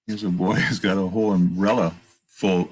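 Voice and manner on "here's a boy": ordinary delivery, nothing marked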